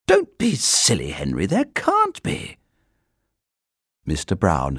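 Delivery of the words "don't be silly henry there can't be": a hint of a wife brushing it off, higher; dismissive wife, lightly voiced. A narrator's reading. Mrs Brown voiced in story.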